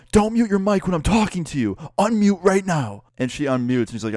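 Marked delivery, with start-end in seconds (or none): 0.1-3.0 imitating a yelling professor, high-pitched; angry yell. Professor scolding student over a muted mic, angry yell. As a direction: yell it as a furious mock command. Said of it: "I hear him yell".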